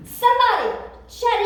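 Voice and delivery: high, loud urgent call; king calling out. Acted. King calling into the furnace, loud and urgent. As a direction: shout for them to come out.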